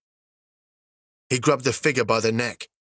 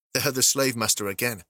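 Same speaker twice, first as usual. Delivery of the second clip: plain reading voice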